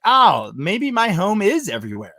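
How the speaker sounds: mild retold revelation; dawning realization. Recalling seeing run-down New Jersey towns, dawning realization.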